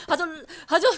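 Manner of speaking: usual speaking voice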